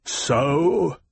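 a cold, controlled single word; cool, clipped-back reply to an insolent officer; coldly controlled. A narrator's reading. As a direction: hold it in, icy and measured. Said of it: "It was a coldly controlled word".

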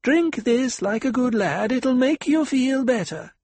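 put-on high-pitched, reasonable coaxing of a drunk patient; reasoning with him in a high, lilting pitch. A narrator's reading. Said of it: "He spoke reasonably".

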